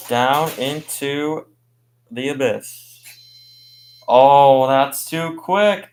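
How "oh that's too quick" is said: excited shout. Real, not acted. Live race call, fast run.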